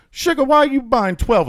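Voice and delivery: high, loud mimic of the Hudson News cashier. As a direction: imitate a doting old lady calling him sugar.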